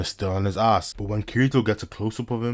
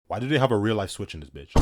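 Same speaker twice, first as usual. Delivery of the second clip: ordinary talk, nothing marked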